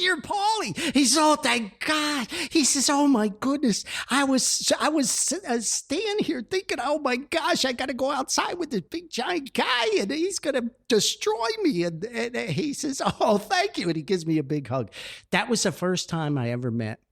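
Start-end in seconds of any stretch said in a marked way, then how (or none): 0.0-0.8 shouted high, amazed realization; relieved surprise. Retold or imitated. Big guy finally realizing who Paulie is, relieved shout. 1.2-11.8 relieved gushing. Retold or imitated. Big guy telling Paulie his fears, high relieved gush. 13.0-13.9 relieved thanks. Retold or imitated. Big guy thanking him.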